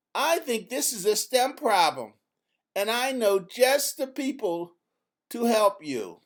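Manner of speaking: a hint of a confident young girl; lightly voiced Izzy. A narrator's reading. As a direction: read Izzy a bit brighter and bolder. Izzy voiced in read story.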